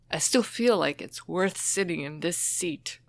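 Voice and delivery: normal talking voice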